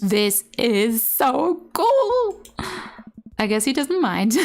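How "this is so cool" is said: excited. Acted. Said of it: "He's vibrating with excitement".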